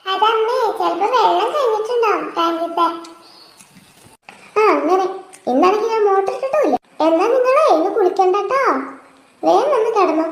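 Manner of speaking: ordinary delivery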